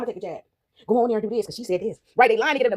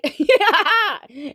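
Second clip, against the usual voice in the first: high, open laugh; real high-pitched cackling laugh; 7 semitones higher, 4 dB louder than usual. Real, not acted. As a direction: burst out laughing. Laughs at own remark.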